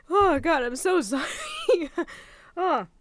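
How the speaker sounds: loud burst, then laughing sorry; half laugh, half sob; laughing through tears. Real, not acted. Overwhelmed, apologizing mid-speech.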